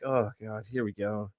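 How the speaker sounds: soft, weary groan of a line; retold resignation. Hearing the gossip about himself, quietly resigned. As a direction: drop the voice, resigned to what's coming.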